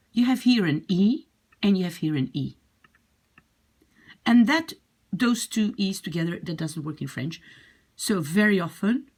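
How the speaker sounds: ordinary talk, nothing marked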